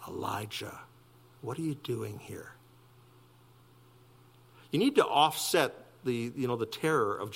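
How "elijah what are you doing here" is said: much softer, a hushed gentle call; the still small voice, softly. Acted. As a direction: speak it like a gentle whisper of God. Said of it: "A soft and gentle voice said".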